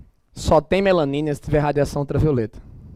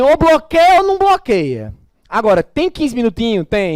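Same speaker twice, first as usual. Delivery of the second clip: exasperated; 6 semitones higher, 8 dB louder than usual. Real, not acted. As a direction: blow up at the student's silly question. Exasperated rant at student.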